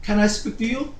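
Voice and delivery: a soft quoted line in English; English phrase. Retold or imitated. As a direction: slip into English for a quick aside. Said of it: "헬라 말로 말한 거예요".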